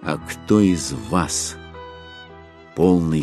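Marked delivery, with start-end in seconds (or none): none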